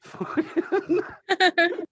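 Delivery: sudden high cackle; high burst of laughter. Real, not acted. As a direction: break into a squealing laugh. Laughing at the Boss Baby caption.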